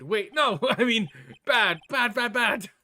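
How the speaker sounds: loud flustered self-correction; flustered backpedal. Acted. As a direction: scramble to take it back. Nick corrects himself flustered.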